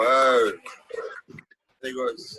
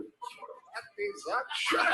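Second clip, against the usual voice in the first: Germans sneering; 7 dB quieter, much slower than usual. Retold or imitated. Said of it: "German team were looking over going".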